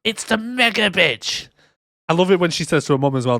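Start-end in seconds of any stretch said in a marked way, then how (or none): 0.0-1.5 high, slow, drawn-out mock monster. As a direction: stretch it out like a silly cartoon beast. Mock creature voice.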